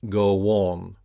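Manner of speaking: "Go on" joined by a w; "Go on" drawn out with no break; linked w glide. Shown as a demonstration. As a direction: model "Go on" as go-won. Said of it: "connected with the off glide U".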